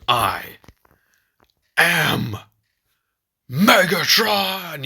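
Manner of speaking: imitated villain scream, slow and building; Megatron. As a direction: build word by word into a loud, high mock roar. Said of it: "he screams it and it echoes".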